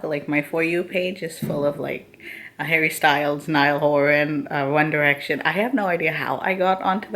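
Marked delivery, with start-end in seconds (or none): none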